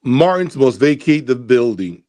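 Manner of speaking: ordinary talk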